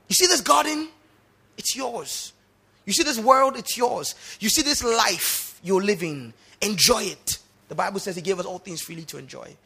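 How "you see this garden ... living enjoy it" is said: high, loud, expansive offer; God generously offering. Acted. God speaking to man, high loud generous offer. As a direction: offer the whole world like a gift.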